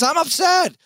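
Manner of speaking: high, loud, upset cry; upset shout. Shown as a demonstration. Announcer showing fans how he calls a painful play, loud upset shout. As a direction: let it burst out, on-air upset. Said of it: "I'm not gonna go quiet in those moments".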